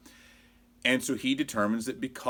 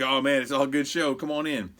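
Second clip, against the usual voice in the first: wrestler switching friendly; 5 semitones higher, 4 dB louder than usual. Retold or imitated. Voices wrestler's friendly afterwards switch.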